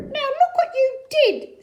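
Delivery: high, loud scolding fish; fish character voice. Acted. Fish scolds the cat, high and loud. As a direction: scold in a squeaky voice. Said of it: "said the fish to the cat".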